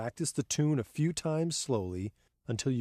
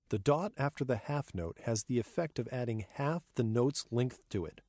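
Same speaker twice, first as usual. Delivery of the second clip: ordinary talk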